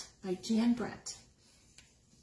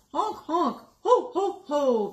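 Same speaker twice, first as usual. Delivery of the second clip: loud honking laugh; honking gander laugh; 4 semitones higher, 7 dB louder, slower than usual. Acted. The gander laughs at Hedgie, loud honking laugh. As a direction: honk and laugh like a goose. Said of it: "the gander laughed".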